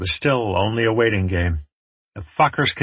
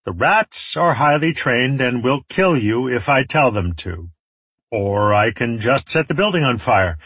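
warlord laying out terms in a raised voice; threatening villain; 4 semitones higher, 3 dB louder than usual. Acted. Enemy leader offers rats or fire, threatening. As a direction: call up the threat, starting high.

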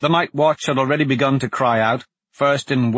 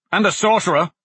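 alarmed; 8 semitones higher, 4 dB louder, faster than usual. Acted. Enemy attack warning.

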